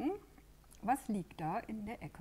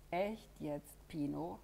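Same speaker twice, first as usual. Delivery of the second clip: ordinary delivery, nothing marked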